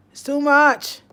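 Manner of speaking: It's too much called out loud, falling on much; loud exasperated cry. Real, not acted. Speaker to her audience, loudly overwhelmed. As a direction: throw it out, exasperated.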